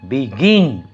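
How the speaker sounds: Begin with strong গিন; begin, light বি and stressed falling গিন; stress on last syllable. Shown as a demonstration. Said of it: "যদি শেষের যে সিলেবলটা".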